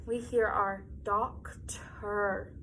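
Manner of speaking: "doctor" slowed to pick out the T; stretched-out word. Shown as a demonstration. Teacher slowing "doctor" so children find the T. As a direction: sound out "doctor" slowly. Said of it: "What other sounds can we hear?".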